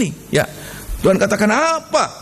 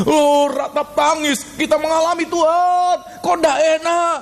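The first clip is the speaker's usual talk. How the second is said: high, drawn-out mock lament; acted wailing complaint; 7 semitones higher, slower than usual. Preacher voicing a believer complaining to God, acted lament. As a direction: plead upward in a stretched, complaining cry.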